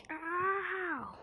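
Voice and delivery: high, drawn-out "ow"; hammed-up wail of hurt; mock pain cry. Hit in pretend fight.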